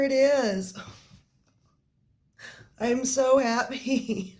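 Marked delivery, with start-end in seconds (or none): 2.8-4.3 higher and slow, drawn out with delight; higher, slow happy gush; gushing joy. Real, not acted. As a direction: savor every word happily. Thrilled over the gift.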